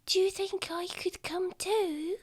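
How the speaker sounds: tiny high hushed plea; whispering little child. Acted. Child asking to join the adventure, high and hushed. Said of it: "Oh, I'm whispering".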